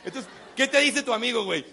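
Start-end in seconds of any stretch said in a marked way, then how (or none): none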